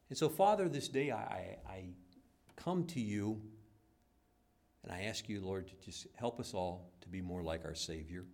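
steady heartfelt prayer voice; earnest prayer. Acted. Pastor leading the congregation in closing prayer. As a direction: pray for the congregation.

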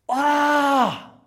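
high loud cry of a desperate beggar; a short shouted cry, high and loud; demonstrated scream. Shown as a demonstration. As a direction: let out a sharp high yell. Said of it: "There was screaming".

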